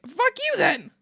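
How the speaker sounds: high, loud shout of anger; mad outburst. Real, not acted. So mad at the tea party guest, angry shout. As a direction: explode at them, then laugh it off. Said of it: "I was so mad".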